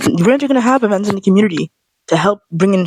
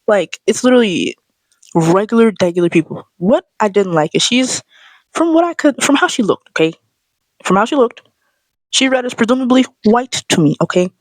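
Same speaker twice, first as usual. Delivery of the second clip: normal talking voice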